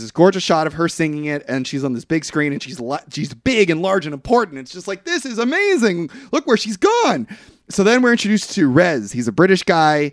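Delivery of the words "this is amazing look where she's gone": high, awed exclamation; mock amazement. Film fan marvelling at her singing, high amazed voice. Voicing awe at her big moment.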